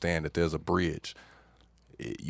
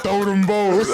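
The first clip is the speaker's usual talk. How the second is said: higher, louder, laughing; 13 semitones higher, 9 dB louder, slower than usual. Retold or imitated. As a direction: quote it while cracking up. Laughing quote amid hysterics.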